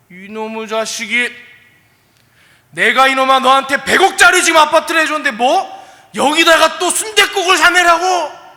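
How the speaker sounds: loud, high furious outburst from an offended rich uncle; angry scolding uncle. Acted. Uncle yelling at his nephew over a bowl of gukbap, furious. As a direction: bellow it like an insulted uncle.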